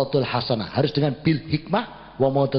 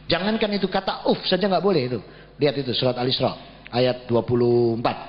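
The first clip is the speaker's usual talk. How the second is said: ordinary talk, nothing marked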